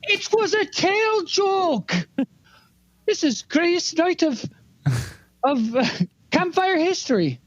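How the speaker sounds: high, slow put-on voice; character voice. Player's rat character protests to the angry cat, high and slow.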